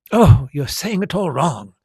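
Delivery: sharp, bossy know-it-all snap; snapping correction. A narrator's reading. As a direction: cut him off impatiently. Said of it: "Hermione snap".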